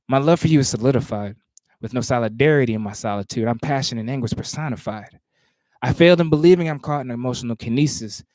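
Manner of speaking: measured, rhythmic poetry recitation; spoken word performance cadence; spoken word poem. Acted. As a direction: perform the poem with weight on each phrase.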